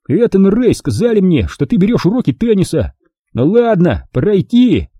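high-pitched, mildly needling tone; mocking. A narrator's reading. Wilson needles Jack about tennis lessons, mildly mocking. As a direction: needle him lightly, then brush him off. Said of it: "с издёвкой произнёс он".

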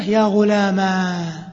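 غلاما ending in a long a, very slow; ghulama. Shown as a demonstration. Teacher demonstrating the fifth form, long final a. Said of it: "بقلب الكسرة ... فتحة".

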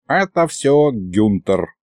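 plain reading voice

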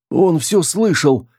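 high, drawn-out panicky cry; hysterical. A narrator's reading. Girl in a man's body fears being overheard, hysterical. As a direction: wail it in rising panic. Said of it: "зашлась в истерике Руди".

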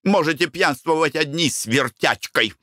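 high-pitched parting jab; irritated. A narrator's reading. Wife leaves husband to drink with the flirt, irritated. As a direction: throw it back huffily. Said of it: "сказала она раздражённо".

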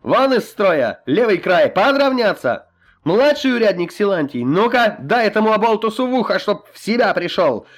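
loud, high drawn-out drill commands; shouting drill sergeant. A narrator's reading. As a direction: drill the cadets at full voice. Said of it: "снова скомандовал". (строевые команды криком)

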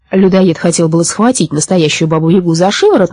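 usual speaking voice

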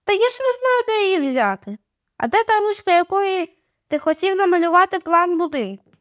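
high-pitched sibling bickering; protesting. A narrator's reading. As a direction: argue back, pitched well up. Siblings quarrel over a pen. (обурене заперечення)

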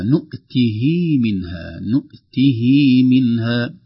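"نؤته" with a long "hii" before "منها"; long silah vowel. Shown as a demonstration. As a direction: stretch the final i of "نؤته", then say "منها". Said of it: "تدل على أن هناك مد".

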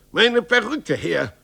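shouting for his wig back. Acted. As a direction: yell it at him. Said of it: "schrie der Mann".

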